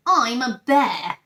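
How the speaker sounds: mock-indignant bear protest; crying out. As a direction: insist firmly, a little offended. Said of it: "he cried".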